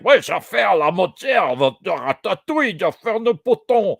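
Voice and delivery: loud, high, garbled mock French from non-native actors; hammed-up imitation of badly spoken French; mock bad French accent. Vlogger mocking Prey's fake French actors, mock bad French accent. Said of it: "are talking like".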